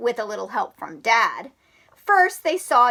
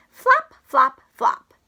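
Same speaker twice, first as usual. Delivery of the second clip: high flop sounds; 12 semitones higher, 4 dB louder, slower than usual. Acted. Flopping sound effect.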